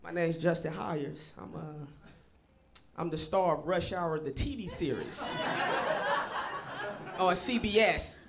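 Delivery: put-on low murmur to a girl on Sunset; smooth pickup line. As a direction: hit on her smooth and low. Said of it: "I'm like".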